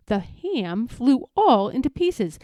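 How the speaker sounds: usual speaking voice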